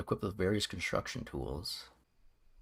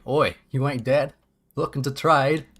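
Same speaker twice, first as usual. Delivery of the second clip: put-on loud, rough British-ish robot accent; bizarre accent; 5 semitones higher, 9 dB louder than usual. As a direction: bark it in a weird Cockney twang. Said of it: "in the most bizarre accent we've ever heard".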